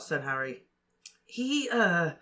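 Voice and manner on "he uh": put-on higher girl's voice, amused; Ginny fighting a smile. A narrator's reading. Ginny explaining Ron's blunder to Harry, holding back a laugh. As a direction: tell it with a smile held back. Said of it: "said Ginny".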